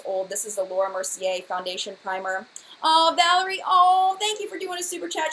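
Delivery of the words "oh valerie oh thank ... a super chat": excited. Real, not acted. Thrilled by viewer super chat.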